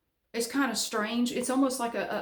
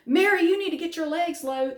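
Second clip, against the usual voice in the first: loud, higher instructor's call across the class; 8 semitones higher, 7 dB louder than usual. Retold or imitated. Instructor calls out to her during flutters, loud and higher. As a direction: bark it out like a fitness coach correcting her. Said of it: "And she goes".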